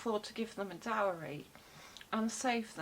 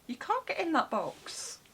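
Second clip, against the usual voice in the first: higher, playful teasing of the cat; pet talk; 7 semitones higher, 3 dB louder than usual. Real, not acted. Talking to her cat, high playful pet voice. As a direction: coo at a cat that won't fit.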